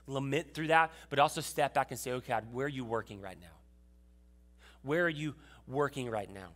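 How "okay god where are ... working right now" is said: soft, low asking God; quiet searching prayer. Pretend. Believer softly asking God where he is working, hushed. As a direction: ask it softly, almost under the breath.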